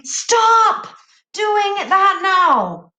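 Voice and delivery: slow, loud, high stern command; stern shout. Shown as a demonstration. Teacher demonstrating an exclamation command, slow and loud. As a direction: snap it out like a cross parent. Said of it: "it's the tone".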